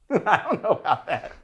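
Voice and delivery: real laughter through the words; laughing hard. Real, not acted. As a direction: crack up while answering. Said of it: "I'm actually laughing so hard".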